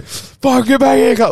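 high, loud imitated scream of rage; high shrieking gamer rage, imitated; retold screaming. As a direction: scream it like a teen chasing his enemy. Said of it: "like, screaming".

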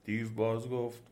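usual speaking voice

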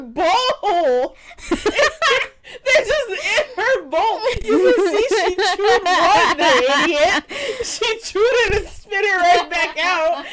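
loud, high, laughing through every word; laughing hard. Real, not acted. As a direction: crack up while telling it. Laughing while telling dog story.

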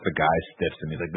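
ordinary delivery

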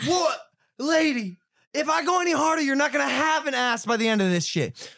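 high-pitched exasperated disbelief; incredulous protest. Retold or imitated. Boxer warning the woman, hammed-up incredulous.

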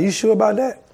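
high, rising sceptical question; doubtful questioning. Acted. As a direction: query it as if doubting God's instruction. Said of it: "You still trying to question God".

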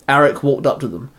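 ordinary delivery

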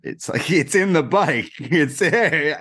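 retold explanation cracked up by laughs; laughing disbelief. Telling paramedics where the finger is, laughing in disbelief.

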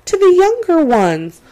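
hammed-up fake sob voice; fake crying. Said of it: "I'm, I'm pretending to cry".

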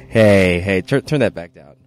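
loud protest. Real, not acted. Telling co-host to lower it.

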